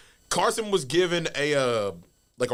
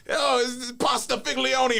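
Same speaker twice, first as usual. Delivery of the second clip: hammed-up, higher, slow Italian accent; bad Italian accent; 10 semitones higher, slower than usual. Wrestler cuts a promo with a rolling pin, bad Italian accent. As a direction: do a horrible mock Italian accent. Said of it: "in the worst Italian accent".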